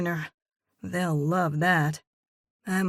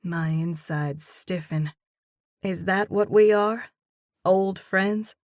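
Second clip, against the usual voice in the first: usual speaking voice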